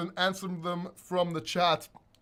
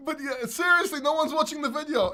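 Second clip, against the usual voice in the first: laughing; 8 semitones higher, 4 dB louder than usual. Real, not acted. Recovering from laughing.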